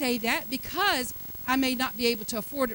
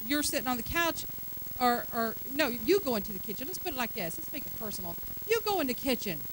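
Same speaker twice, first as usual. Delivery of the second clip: normal talking voice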